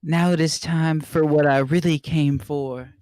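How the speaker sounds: loud, declaring villain; villain announcement voice. Acted. Co-reader voices Shadow.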